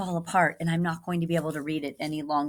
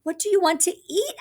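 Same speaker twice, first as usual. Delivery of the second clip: much higher, louder asking; eager question; 13 semitones higher, 5 dB louder, faster than usual. Acted. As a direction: call out to the creature. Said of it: "asked the townspeople".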